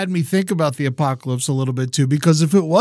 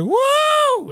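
imitated whoop. Imitating the dentist cheering as she pops the abscess, high whoop. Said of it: "yelling when she was doing it too".

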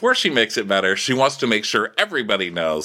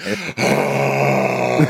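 big breathy mock sigh; long exasperated sigh; 3 dB louder than usual. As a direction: let out a long, weary "oh". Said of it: "And Garcelle just sighs".

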